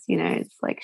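ordinary talk, nothing marked

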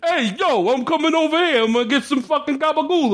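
high-pitched cartoon Brooklyn guy; exaggerated, high Brooklyn mimicry; over-the-top Brooklyn accent. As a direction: go crazy with a Brooklyn wiseguy accent. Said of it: "like, a guy from Brooklyn accent".

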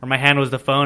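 normal talking voice

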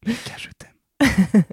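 slow, high breathy whisper; drawn-out playful whisper. Pretend. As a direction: whisper a teasing love note into the mic. Whispering to the sound mixer.

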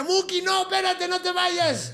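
high, loud yell after him; a high, pleading call to stop the departing Muki; desperate shout. Retold or imitated. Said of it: "Y grita".

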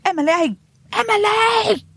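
high, piercing landlady's call from below; sharp, high summons up the stairs; shrill calling. A narrator's reading. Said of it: "a shrill voice calling her name".

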